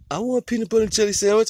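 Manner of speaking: put-on high whiny kid voice; little nephew voice. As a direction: whine it like a small child. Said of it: "So my nephew like".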